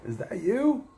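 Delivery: high, rising cry of recognition; surprised celebrity spotting an acquaintance; Howard Stern greeting. Retold or imitated. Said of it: "and he went".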